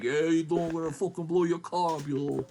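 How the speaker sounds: hammed-up Staten Island mobster; mock Staten Island tough guy. Locals threatening to blow up his car, Staten Island accent. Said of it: "We're like".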